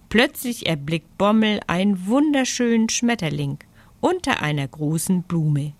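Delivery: usual speaking voice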